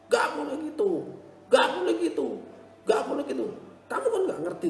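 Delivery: put-on high scolding shout at a child; ibu berteriak ke anak. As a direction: yell it like a fed-up mother. (teriakan ibu memarahi anak)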